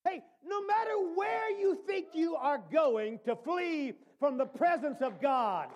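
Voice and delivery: ordinary talk, nothing marked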